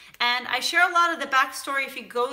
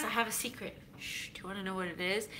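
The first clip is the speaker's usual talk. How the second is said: hushed, much softer and quicker; secret hush; 5 semitones lower, 12 dB quieter, faster than usual. Pretend. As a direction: lean in and tell a secret softly. Sharing a secret with viewers.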